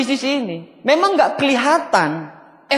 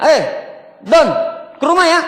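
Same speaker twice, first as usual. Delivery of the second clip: calling out; 3 semitones higher than usual. Retold or imitated. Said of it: "Upline panggil downline-nya".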